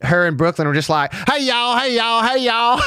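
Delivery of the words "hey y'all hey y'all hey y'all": high, hammed-up Southern twang; high mock Southern hey y'all. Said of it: "Maybe that's why her and Brooklyn bonded".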